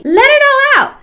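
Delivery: high loud bubbly first take; mock cheer. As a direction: chirp it out.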